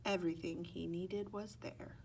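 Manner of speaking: ordinary delivery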